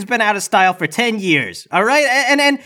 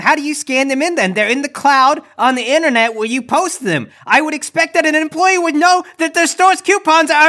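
entitled customer; 8 semitones higher than usual. Acted. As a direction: demand it like an entitled shopper.

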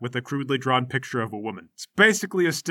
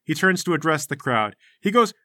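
usual speaking voice

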